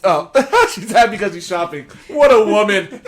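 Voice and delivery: laughing through the words; words breaking into laughter. Real, not acted. Laughing while talking.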